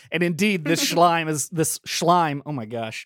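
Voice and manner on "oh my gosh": usual speaking voice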